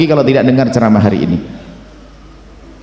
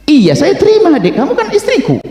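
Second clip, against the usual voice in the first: much higher-pitched voice of an insistent husband; insistent reassurance; 12 semitones higher than usual. Acted. Husband reassuring his doubting wife that he accepts her, high and insistent. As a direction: reassure emphatically, voice pitched right up.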